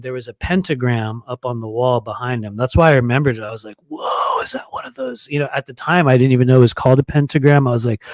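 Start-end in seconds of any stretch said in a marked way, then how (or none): 3.9-5.3 whispered whoa, then a sudden high, soft question; hushed shock, voice jumping up on the question; surprised. Retold or imitated. Narrator spotting a pentagram behind the kid, hushed startled surprise.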